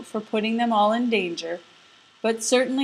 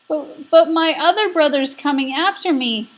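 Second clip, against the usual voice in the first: higher, louder scared goat voice; bleating goat; 6 semitones higher, 5 dB louder than usual. Acted. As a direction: stammer like a frightened goat. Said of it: "bleated the middle-sized goat".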